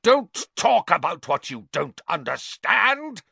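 high, loud, unhinged; deranged shout. Acted. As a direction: shout like he's lost it. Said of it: "looking suddenly quite deranged".

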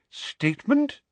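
high, rising puzzled question; slow, puzzled. A narrator's reading. Minister confused by the offer of confession, puzzled. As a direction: echo the word in high-pitched bafflement. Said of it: "said Fudge slowly".

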